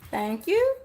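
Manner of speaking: bright, lifted thank you with relief; grateful thanks. Real, not acted. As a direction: thank her as the water finally comes. Water finally arrives.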